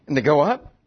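usual speaking voice

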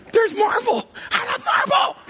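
high gleeful shout of love for marble; ecstatic squeal. Acted. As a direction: squeal with delight over the steak. Ecstatic about steak marble.

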